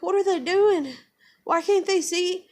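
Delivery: put-on high-pitched pleading kid voice; retold toddler voice. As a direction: imitate an upset little girl. Voices the distressed daughter.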